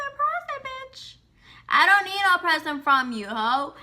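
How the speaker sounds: high, loud, indignant shriek of disbelief; outraged mock yell. Telling off the harasser who wants to give her a present, high outraged yell. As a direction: shriek it back at him like he's lost his mind. Retelling angry reply to harasser.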